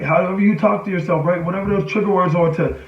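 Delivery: ordinary talk, nothing marked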